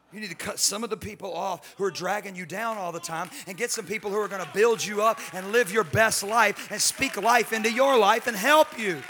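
rising sermon climax; preaching at full force. Acted. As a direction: build the preaching up to a shout.

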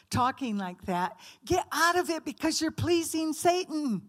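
ordinary delivery

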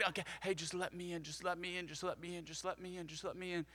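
quiet coaxing, let me in; soft pleading Jesus. Retold or imitated. Jesus knocking, softly asking to be let in. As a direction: gently plead at the door, softly.

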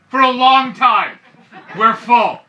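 loud, slowed-down retort; yelling. Retold or imitated. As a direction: yell it slowly, spelling it out for the customer. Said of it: "why are you yelling".

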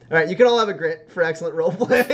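fast laughing praise. Real, not acted. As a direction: rush it out laughing.

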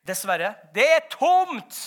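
loud, high, drawn-out announcement; calling back. Acted. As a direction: slowly call the bad news to the back rows. Answering the shouting hungry crowd.

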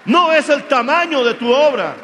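preacher pressing the congregation, loud and deliberate; full-force preaching. Acted. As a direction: hammer each phrase home.

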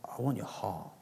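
softly voicing God's tender words. Preacher softly voicing God's words of love to the congregation. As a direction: speak it softly, like a father to his child. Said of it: "I felt him saying".

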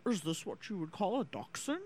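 lightly voiced movie president asking a question; Nixon. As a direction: put on a slightly odd, quoted film voice. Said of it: "we say it in that voice".

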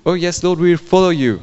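high, loud, fervent yes to the Lord; eager crowd vow. Acted. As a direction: cry it out like a whole crowd swearing loyalty. Interpreter echoes people's vow.

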